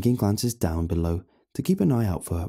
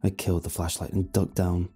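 ordinary delivery

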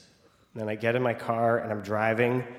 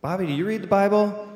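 higher, louder sincere question; earnest dad; 8 semitones higher, 4 dB louder than usual. Retold or imitated. Dad asks the catcher about faith, higher and earnest. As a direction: ask it with solemn sincerity. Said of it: "He says".

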